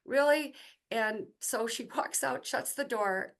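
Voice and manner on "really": loud, high incredulous "Really?"; disbelieving really. Retold or imitated. Surprised reaction to being presented.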